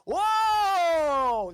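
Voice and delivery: high, loud, long whoa; wowed audience's drawn-out cry; amazed crowd. Acted. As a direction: shout it out and let it slide down.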